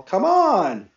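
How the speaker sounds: loud, high impatient call; yelling. A narrator's reading. Someone in the outside hall urging another, yelling. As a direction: yell to hurry someone along. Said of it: "someone yelled in the outside hall".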